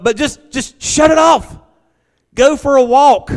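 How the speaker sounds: high, loud preacher's exhortation; full-force preaching. Acted. Pastor urging church to turn off the news, loud preaching. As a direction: urge the church to switch off.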